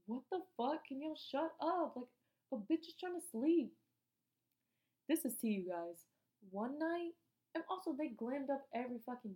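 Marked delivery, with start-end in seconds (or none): 0.0-3.8 higher, louder mock snapping at noisy roommates; annoyed outburst. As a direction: tell the girls off for waking you. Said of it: "I would just be like".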